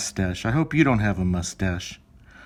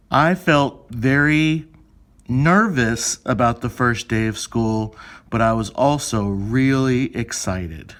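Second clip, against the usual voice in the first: slow, careful and louder, as if into a microphone; slow recording voice; 4 dB louder, slower than usual. Shown as a demonstration. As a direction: speak each word clearly for the recording. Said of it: "I'm gonna record myself saying how I felt".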